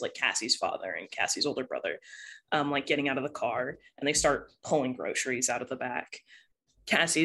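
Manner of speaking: ordinary delivery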